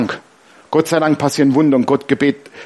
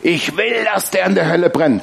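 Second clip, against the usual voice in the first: imitated vengeful declaration. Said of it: "einer der ehrlichsten Momente".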